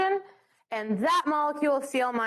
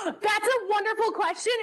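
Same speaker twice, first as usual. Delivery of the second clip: delighted gasp; 8 semitones higher than usual. Real, not acted. Teacher thrilled by a student's question, gasping delightedly. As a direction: gasp then an eager, higher "wonderful question".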